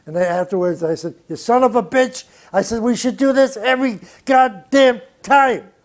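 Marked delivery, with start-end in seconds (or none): 1.3-5.7 loud, higher, delighted swearing; gleeful cursing. Retold or imitated. Producer praising Miles after the solo, loud and gleeful. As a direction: cheer him on with a curse.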